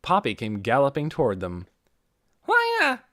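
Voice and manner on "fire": put-on high little girl's yell. A narrator's reading. Little girl yells to the doctor about fire. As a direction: cry it out like a frightened child. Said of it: "she yelled".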